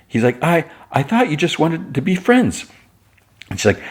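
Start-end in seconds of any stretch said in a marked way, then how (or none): 0.4-2.7 high, stammered mock protest; defensive protest. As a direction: play a flustered friend explaining himself. Jimmy defends himself in quarrel.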